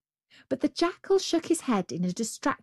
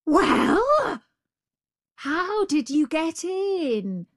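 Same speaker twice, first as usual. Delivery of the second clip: much higher, slow innocent voice; sly dim jackal; 9 semitones higher, 4 dB louder, much slower than usual. Acted. The jackal feigns ignorance to the tiger, high. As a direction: play dumb, wide-eyed.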